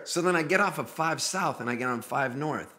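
ordinary talk, nothing marked

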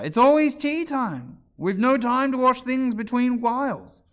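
high, loud, slow complaint; exaggerated long-suffering Hatter; sighing lament. A narrator's reading. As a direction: wail it wearily as a drawn-out lament. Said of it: "said the Hatter with a sigh".

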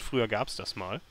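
usual speaking voice

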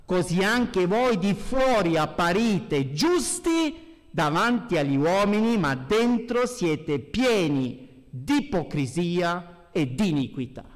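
ordinary delivery

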